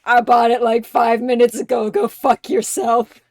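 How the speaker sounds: loud mock-rich-brat retort; retold angry comeback. Bruce telling off his shithead friend, loud mock outburst. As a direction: throw it back loudly at a club friend.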